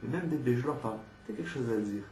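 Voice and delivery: normal talking voice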